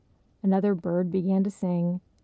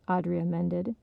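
ordinary delivery

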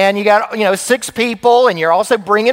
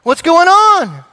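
exasperated dad; 7 semitones higher, 4 dB louder, slower than usual. Retold or imitated. Dad at pump, kids not out.

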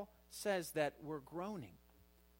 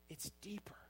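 hushed whisper for weight; 5 semitones higher, 10 dB quieter than usual. Real, not acted. Preacher to congregation, whispered emphasis. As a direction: drop to a whisper to draw them in. Preacher stresses the deeper longing.

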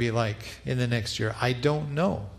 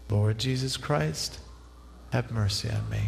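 breath prayer; 3 dB quieter than usual. Shown as a demonstration. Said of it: "Try it one more time".

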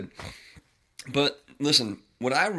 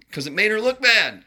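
indignant outburst; 11 semitones higher, 10 dB louder than usual. Real, not acted. Angry rant about his wife.

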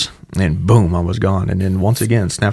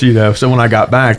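usual speaking voice

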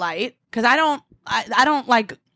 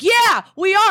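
high, louder mock shout; shouting back; 11 semitones higher, 5 dB louder, slower than usual. Three guys answer the angry cyclist, shouting back. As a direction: throw it back as a raised, high retort.

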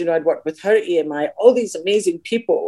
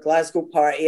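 usual speaking voice

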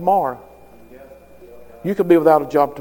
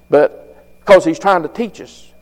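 ordinary delivery

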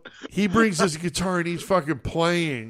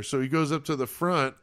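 ordinary delivery

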